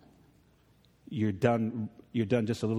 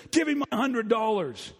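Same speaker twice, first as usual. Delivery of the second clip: demanding; 8 semitones higher than usual. Retold or imitated. Servant demands debt back.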